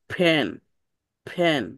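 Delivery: "ফ্যান" starting from closed lips with a light puff; aspirated ফ. Shown as a demonstration. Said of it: "হালকা বাতাস বের হবে". (দুই ঠোঁট জুড়ে হালকা বাতাসে "ফ্যান")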